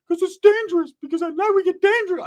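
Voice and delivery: very high squeaky cousin voice; comically high put-on voice; Ricky. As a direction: mimic him way up high. Ricky explains on the phone.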